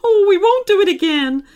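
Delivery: loud high panicked promise; frightened cry. A narrator's reading. Vandals fleeing the werewolf, loud high cry. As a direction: cry out in fear. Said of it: "they cried".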